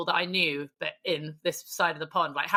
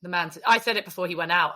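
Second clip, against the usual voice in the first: usual speaking voice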